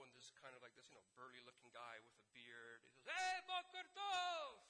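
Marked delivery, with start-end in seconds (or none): 3.1-4.6 loud, high, drawn-out holler; hammed-up tour guide's bellow; booming greeting. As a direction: burst in shouting hello.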